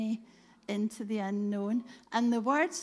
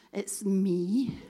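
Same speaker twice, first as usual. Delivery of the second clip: imitated Glasgow announcement, louder; little Glasgow voice; 7 dB louder than usual. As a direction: mimic a wee Glasgow voice, loudly. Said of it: "this little Glasgow voice said".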